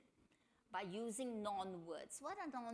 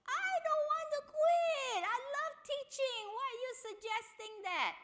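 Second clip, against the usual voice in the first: high, loud wailing protest; mock bawling; 10 dB louder than usual. Teacher wailing at husband, high loud mock bawl. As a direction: wail it like a crying wife. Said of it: "she bawled even louder".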